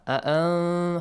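أأن ending in a held nasal hum; hidden noon. Shown as a demonstration. Teacher demonstrating ikhfa, أأن with a hummed noon. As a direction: hold the noon of أأن in the nose without fully sounding it. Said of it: "hide the sound of noon".

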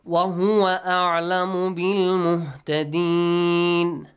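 loud, sustained chant with an extended ending; recite, drawing out the last long vowel; Quranic recitation. Shown as a demonstration. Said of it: "only an extension at the end".

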